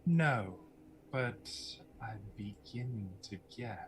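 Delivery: soft, measured pauses between phrases; guarded, enigmatic woman; mysterious villainess. Acted. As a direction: let each phrase fall away quietly. Game master voices Lucretia.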